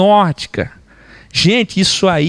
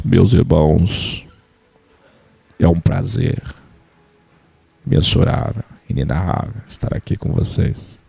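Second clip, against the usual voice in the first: mock preacher voice; 12 semitones lower than usual. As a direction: ham up the fake solemn preacher. Mocking the artificial preacher voice.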